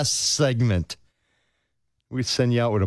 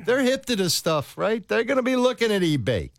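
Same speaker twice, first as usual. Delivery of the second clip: excited; 11 semitones higher than usual. Real, not acted. Excited about police catching her.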